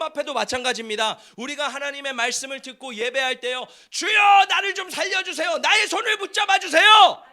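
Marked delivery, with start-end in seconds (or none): 3.9-7.2 loud, high pleading cry to the Lord; cling and cry out for rescue; desperate plea. Shown as a demonstration. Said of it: "이렇게 주님의 손을 매달리고 나아가는 거예요". (주님께 매달리며 크게 부르짖는 간청)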